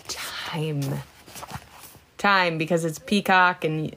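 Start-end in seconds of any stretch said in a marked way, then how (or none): 0.1-0.8 whispered. Real, not acted. Trailing whisper on a word.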